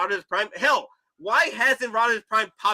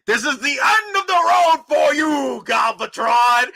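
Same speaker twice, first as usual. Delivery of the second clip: slow, shouted heroic line; movie line quote; 7 semitones higher, 4 dB louder, slower than usual. Retold or imitated. Hero confronting Galvatron, slow heroic shout. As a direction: declaim it like a hero's vow. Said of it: "brought up a good one".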